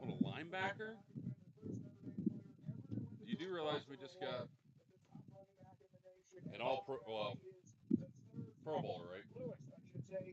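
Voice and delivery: high, incredulous mock question; sarcastic disbelief at the request. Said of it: "I'm being sarcastic".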